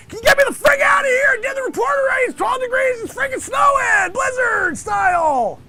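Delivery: frantic high yelling in the cold; shouting over the storm. Acted. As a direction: yell it out against the blizzard. Freezing reporter yelling in blizzard.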